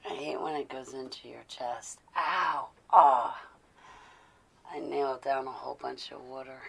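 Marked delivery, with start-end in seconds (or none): none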